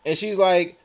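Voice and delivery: ordinary talk, nothing marked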